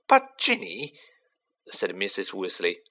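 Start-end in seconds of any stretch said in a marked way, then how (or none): 0.1-0.9 high, pleading mother's voice; frightened mother demanding answers; anxious mother. Acted. Worried mother interrupts.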